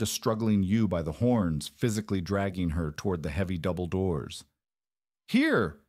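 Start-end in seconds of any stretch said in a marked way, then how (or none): 5.3-5.8 high, raised one-word call; commanding roar. A narrator's reading. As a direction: roar it to cut through the panic. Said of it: "He roared at the younger hands".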